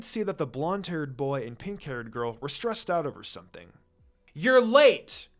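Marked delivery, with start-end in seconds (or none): 4.3-5.3 loud, higher shout of reproach; yelling. Acted. Two teammates yelling at their late teacher, loud shout. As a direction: call it out in annoyance. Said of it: "the two yelled".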